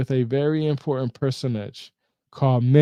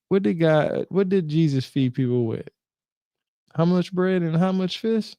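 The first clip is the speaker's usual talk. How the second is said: normal talking voice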